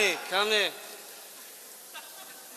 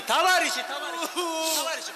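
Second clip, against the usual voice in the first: calling out; 6 semitones higher than usual. Acted. Officer calling out.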